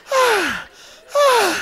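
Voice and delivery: rising high yelps; high screams. Retold or imitated. Alone in the freezing shower, high yelps. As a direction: yelp at the freezing spray.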